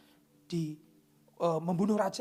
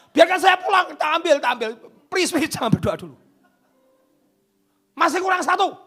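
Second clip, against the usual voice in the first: high, loud desperate begging demon; frantic shouted plea not to pray; pleading demon; 13 semitones higher, 16 dB louder than usual. Acted. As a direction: shout it like a panicked spirit begging. Demon pleading in preacher's story.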